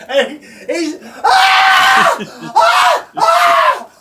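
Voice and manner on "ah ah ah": repeated high, piercing screams; imitated terrified screams. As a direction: mimic a panicked brother screaming. Imitates his brother's terrified screaming.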